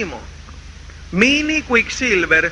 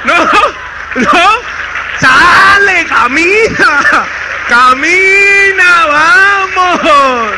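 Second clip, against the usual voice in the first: loud, slow shouts to get moving; shouted orders; 4 semitones higher, 6 dB louder, much slower than usual. Acted. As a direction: bark them forward, drawn out. Urging a lagging member forward.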